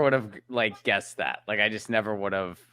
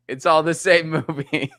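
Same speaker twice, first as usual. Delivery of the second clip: loud, raised voice breaking into laughter; laughing; 4 semitones higher, 5 dB louder than usual. Real, not acted. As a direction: crack up at your own joke. Joking about Tom Cruise movies.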